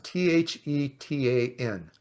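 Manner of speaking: ordinary talk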